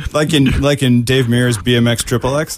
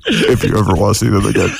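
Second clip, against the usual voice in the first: cold rasping evil emperor voice; Dark Lord; 2 semitones lower than usual. Pretend. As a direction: menace him like a villain. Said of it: "Sounds like the Dark Lord".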